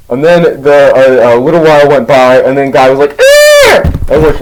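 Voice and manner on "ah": very high hammed-up shriek; sudden falsetto scream of disgust; mock scream. Guy screams at what's on his chip, mock horror. Friend screams at second find.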